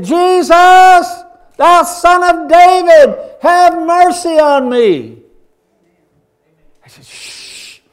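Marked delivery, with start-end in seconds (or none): 0.0-5.2 high, loud, slow pleading cry; desperate shout. Acted. Blind Bartimaeus begging Jesus for mercy, a loud high cry. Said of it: "he yelled and he cried". 7.0-7.8 shushing. Acted. Crowd hushing the beggar.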